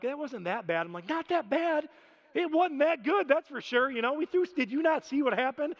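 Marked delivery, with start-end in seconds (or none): 1.1-1.9 indignant disbelief. Retold or imitated. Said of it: "I, I lost it". 2.3-5.8 raised, higher exasperated protest; upset retort. Retold or imitated. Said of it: "don't understand how upset you are".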